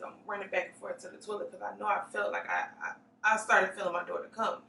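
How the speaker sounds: normal talking voice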